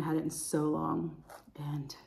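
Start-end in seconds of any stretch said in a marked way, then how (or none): 1.6-1.9 hushed, breathy secret; whisper. Real, not acted. Confiding to viewers about ranch, whispered. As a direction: whisper it like a confession.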